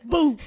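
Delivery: short, punchy mock bark; sudden dog-like burst; imitated loud bark. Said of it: "Like, so loud".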